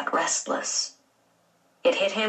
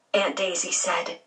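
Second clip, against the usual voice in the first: plain reading voice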